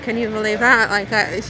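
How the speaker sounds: ordinary talk, nothing marked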